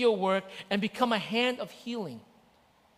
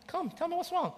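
higher, gentle coaxing; coaxing dad; 8 semitones higher, 5 dB quieter than usual. Retold or imitated. Father coaxing his sad son to talk. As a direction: draw the boy out kindly.

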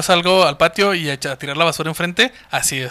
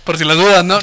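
ordinary delivery

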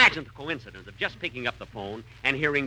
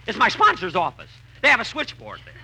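louder, higher, exasperated calls; impatient calls; 8 semitones higher, 6 dB louder than usual. Acted. Comedian mimicking the frantic phone scene, louder. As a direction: bark for the operator. Jack losing patience with operator.

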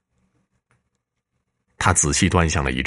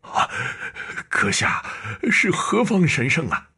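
high, trembling, barely able to form words; voice failing with fear; 11 semitones higher than usual. Acted. Old painter stammering in terror at the ghost general. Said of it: "语不成声地说".

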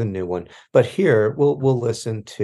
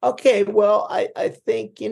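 much higher, squeaky; 12 semitones higher than usual. Pretend. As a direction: squeak it out in a high voice. High squeaky aside.

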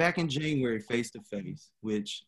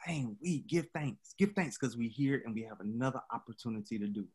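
quiet solemn; 7 dB quieter than usual. Real, not acted. Solemn reflection on loss.

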